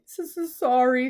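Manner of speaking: stammering sob, breaking high; the giant sobbing his apology, stuttering. A narrator's reading. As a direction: choke the apology out through tears. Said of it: "sobbed Hagrid".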